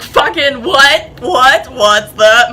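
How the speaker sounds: loud, alarmed, rapid-fire questions; freaked-out panic. Retold or imitated. Spooked in the dark field, she yells at Nicholas in panic. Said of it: "like, lose my shit".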